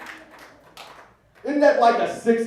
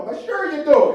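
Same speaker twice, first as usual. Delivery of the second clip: Uncle Mike voice; 9 semitones higher, 5 dB louder than usual. Retold or imitated. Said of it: "Uncle Mike quote".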